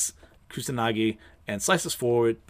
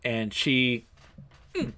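usual speaking voice

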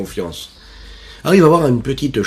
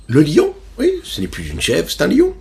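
1 excited emphasis; 8 semitones higher, 4 dB louder than usual. A narrator's reading. Emphatic reveal in story.

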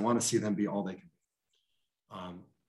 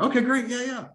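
much higher, bright burst of yes; delighted okay; 9 semitones higher than usual. Retold or imitated. Evangelist agreeing to meet, pitched up and thrilled. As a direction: jump at it, thrilled.

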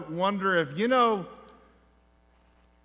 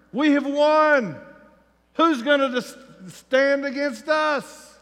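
loud, higher swaggering boast; cocky boast; 5 semitones higher, 5 dB louder than usual. Retold or imitated. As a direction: puff up like invincible warriors. Israelites boasting in their minds.

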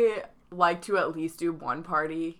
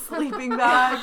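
laughing; 6 semitones higher, 6 dB louder, slower than usual. Real, not acted. Amused echo of friend's story.

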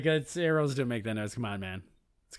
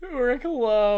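high, loud teasing echo; mocking echo; 9 semitones higher, 6 dB louder, much slower than usual. Real, not acted. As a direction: sing the nickname back, amused. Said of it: "repeats the nickname".

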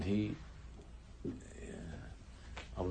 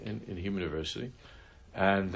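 ordinary talk

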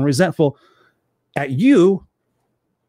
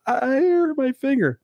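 put-on high, drawn-out child's whine; mock six-year-old; 11 semitones higher, slower than usual. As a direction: whine slowly like an over-coddled little kid. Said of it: "they're six years old and go".

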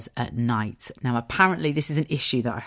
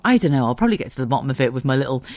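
ordinary delivery

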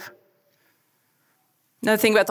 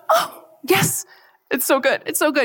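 much higher, gasping delight; delighted joy; 6 semitones higher than usual. Pretend. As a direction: gush over a found gift. Delighted finding Spirit's hidden gift.

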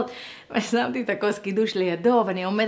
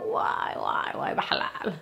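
mock groan; 5 dB quieter than usual. Exclaiming at inner conflicts.

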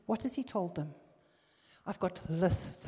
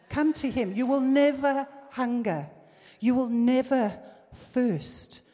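higher and louder, pleading with the crowd; fervent altar call; 5 semitones higher, 7 dB louder than usual. Real, not acted. Preacher urging the congregation to come to Jesus, loud and fervent. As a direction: call them forward with fire.

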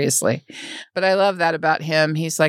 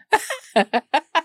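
high laugh; 9 semitones higher than usual. Real, not acted. Laughs at the remark.

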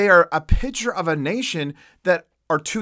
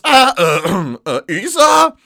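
faked Esau voice; 2 semitones higher, 8 dB louder, slower than usual. Jacob faking his brother's voice to his father, strained and loud. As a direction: clear the throat and bluff a name. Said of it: "trying to do an imitation of Esau's voice".

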